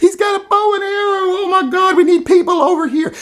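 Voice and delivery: very high, frantic mock scream. Mocking a protester screaming for backup, very high. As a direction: squeal for help in fake panic. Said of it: "One of them is screaming".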